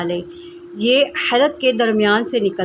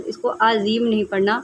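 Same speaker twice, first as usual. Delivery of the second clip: normal talking voice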